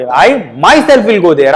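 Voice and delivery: myself leaned on hard, the whole line very slow; stress on myself. Shown as a demonstration. Teacher showing students how to stress myself, very slow. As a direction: press down on myself, slowly. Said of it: "जोर देकर बोला".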